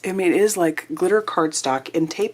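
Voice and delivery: normal talking voice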